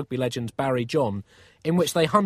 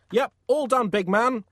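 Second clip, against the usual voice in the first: high, raised-voice reply; calling back cheerily; 9 semitones higher, 5 dB louder than usual. Acted. Painter answers the shouting Pope, calling back cheerily. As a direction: call it back breezily.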